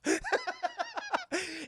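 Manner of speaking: long high laugh; helpless high giggle. Real, not acted. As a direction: crack up, pitched high. Laughing at the retold exchange.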